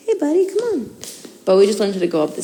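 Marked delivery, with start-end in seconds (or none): 0.1-1.0 bright, high call to the puppy; high pet talk. Real, not acted. Owner calling her puppy over to the stairs, high coaxing voice. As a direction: lift the voice way up to coax the dog.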